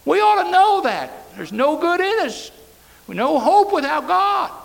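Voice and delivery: loud, high pulpit exhortation; preaching at full force. Acted. Preacher exhorting congregation on helplessness, loud high preaching. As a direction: drive the point home to the church.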